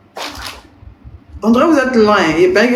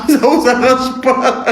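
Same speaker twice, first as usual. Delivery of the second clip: laughing; 6 semitones higher than usual. Real, not acted. Laughing repeat of a viewer's phrase.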